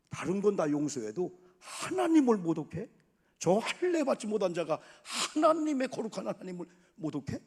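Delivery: loud, outraged challenge; indignant outburst. Acted. David raging at Goliath for blaspheming God, indignant.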